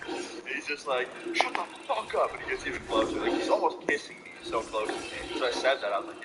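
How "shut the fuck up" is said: much higher imitation of a cross rival; mock angry outburst. Rival cutting off his teasing, high mock anger. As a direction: mimic a riled-up rival, pitched right up. Rival snapping back, retold.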